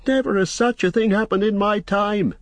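high-pitched, aghast landlord; horrified cry. A narrator's reading. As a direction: wail it high in horror. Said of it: "he cried, raising his hands in horror".

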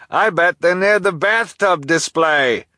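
high, loud slow call; delivery man calling out his guess. Acted. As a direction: call across the store. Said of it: "the delivery person called".

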